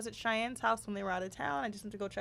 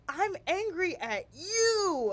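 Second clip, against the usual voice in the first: put-on slow, higher, sulky little kid voice; pouting toddler; 9 semitones higher, 4 dB louder, much slower than usual. Mother imitating her son saying he is angry, slow sulky. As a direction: mimic a two-year-old declaring it slowly. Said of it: "you said".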